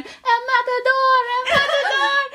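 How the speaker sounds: high, loud mock scream in Spanish; retold scream. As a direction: yell it like a panicked neighbor. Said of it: "the woman is screaming".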